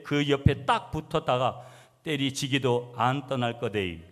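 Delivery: folksy Gyeongsang-dialect scripture reading. Shown as a demonstration. Preacher reads Psalm 23 to congregation for humor, in Gyeongsang dialect. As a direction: recite in broad Gyeongsang dialect. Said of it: "시편 이십삼 편 경상도 버전".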